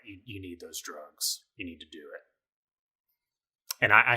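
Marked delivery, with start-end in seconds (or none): 0.1-2.2 inner drug voice. Retold or imitated. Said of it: "my electrochemistry kicks in and is like".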